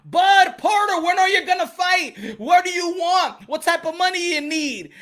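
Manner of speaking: high, loud, insistent demanding; loud calling out. Real, not acted. Host demands answers from the fighters, loud call-out. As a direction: fire questions at them at full voice.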